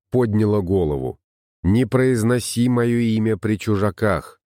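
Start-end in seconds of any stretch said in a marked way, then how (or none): none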